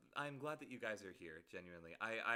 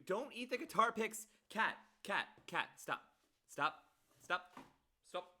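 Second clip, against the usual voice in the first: scolding the cat; 10 semitones higher, 6 dB louder than usual. Real, not acted. Cat chewing guitar picks.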